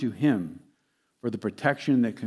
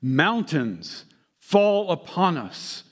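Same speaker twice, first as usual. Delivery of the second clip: drawn-out, higher-pitched cry of appeal; 6 semitones higher, slower than usual. Acted. Earth dwellers pleading with the mountains for protection, crying out. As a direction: call out slowly, pitch lifted, without a full shout. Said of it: "they cry out".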